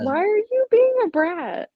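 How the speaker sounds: high-pitched coo of reproach; playful pet scolding. Real, not acted. Owner asks the cat why she's misbehaving, high playful scolding.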